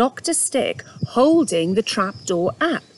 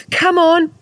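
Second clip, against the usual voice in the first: high, loud effortful urging; straining; 9 semitones higher, 7 dB louder than usual. Acted. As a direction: grunt it out while pulling. Dog strains at trap.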